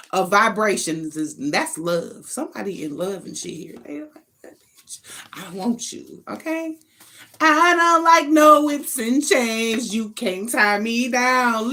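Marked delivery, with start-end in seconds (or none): 3.9-6.8 breathy mock whisper of a lover; teasing whisper. Tarot reader whispering a lover's mock confession. 7.4-11.7 slow, loud, high sassy voicing; mock defiant lover. Tarot reader voicing a sassy lover who refuses ties.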